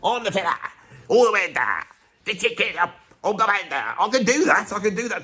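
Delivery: a touch of brash Cockney bravado; Cockney bloke. Retold or imitated. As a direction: swagger it like a Norman Wisdom Cockney. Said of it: "bit of Norman Wisdom Cockney thing".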